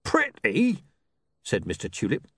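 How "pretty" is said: much higher, louder outraged question; appalled at the word; incredulous. A narrator's reading. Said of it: "despairing of his colleague's taste".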